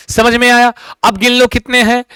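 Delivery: ordinary delivery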